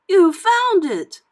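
high, loud, drawn-out amazement; surprised officer. A narrator's reading. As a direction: exclaim slowly in delighted surprise. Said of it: "the officer said".

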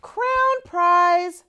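ordinary delivery

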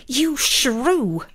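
high screeched insult; screeching with affront. A narrator's reading. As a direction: hurl the insult in a high, outraged voice. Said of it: "she screeched".

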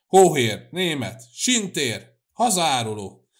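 loud, punched insults, one by one; furious name-calling. A narrator's reading. Two old men blue with rage yell insults, furious name-calling. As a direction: hurl each word as a separate shout. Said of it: "ahogy egymásra ordítozott".

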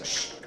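sharp unvoiced shush to hush the room. Real, not acted. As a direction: hush the class with a long shh. Teacher hushing the class.

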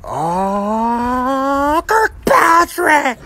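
slow, high shouted Kirkpatrick; drawn-out shout. Acted. Calling out the champion's name in a slow shout. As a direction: stretch the name out like a war cry.

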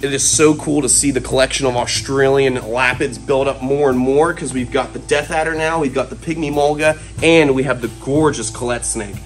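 normal talking voice

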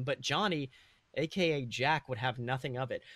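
usual speaking voice

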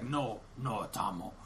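imitation of a very low-voiced robot; deep robot voice. Imitating the robot naming the comic character, deep voice. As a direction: drop right down into a mock robot voice. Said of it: "he has, like, a deep voice".

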